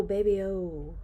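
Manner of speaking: ordinary delivery, nothing marked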